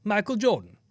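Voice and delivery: a hint of a Michael Jordan voice; Michael Jordan quote. Host reading a fan's donation quote in a hint of Jordan's voice. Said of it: "To quote a better basketball player".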